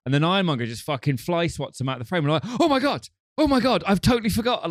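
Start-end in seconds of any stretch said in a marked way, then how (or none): 2.6-4.7 high-pitched shocked reaction; astonished. Retold or imitated. Podcaster reliving the villain's sudden comeback, astonished. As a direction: relive the surprise, voice jumping right up. Acts out surprise at villain's return.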